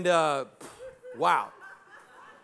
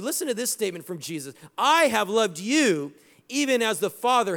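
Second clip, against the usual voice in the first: preaching; 5 semitones higher, 4 dB louder than usual. Acted. Preaching again after the story.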